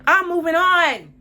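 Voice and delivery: loud, high, drawn-out declaration; defiant shout. Real, not acted. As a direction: shout it out slowly, high and emphatic. Emphatic outburst at ex.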